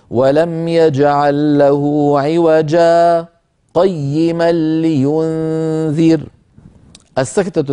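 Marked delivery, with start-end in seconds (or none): 0.1-6.2 slow chanted recitation with a brief breathless pause after عوجا; Quran recitation with saktah. Shown as a demonstration. Teacher shows viewers the pause, in slow chanted recitation. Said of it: "لابد من سكتة لطيفة هكذا".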